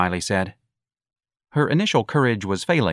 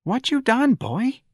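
high, shaky worried mother; trembling voice; slower than usual. A narrator's reading. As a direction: plead with her son, trembling. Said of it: "in a trembling voice".

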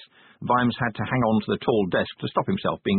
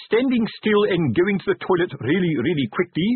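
higher-pitched sergeant's mischief; teasing sergeant; 9 semitones higher, 3 dB louder than usual. A narrator's reading. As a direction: keep needling the nervous recruit. Fred teases the panicking recruit.

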